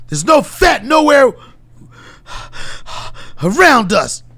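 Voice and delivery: winded, forceful motivational rant in short bursts; hammed-up tough talk at the end of a run; David Goggins impression. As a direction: pant heavily between emphatic phrases. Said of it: "incredible David Goggins".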